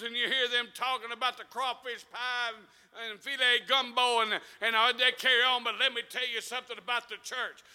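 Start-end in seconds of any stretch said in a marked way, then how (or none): none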